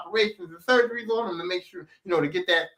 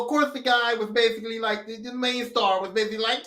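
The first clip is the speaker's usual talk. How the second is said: ordinary talk, nothing marked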